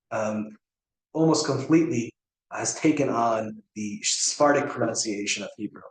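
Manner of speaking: ordinary delivery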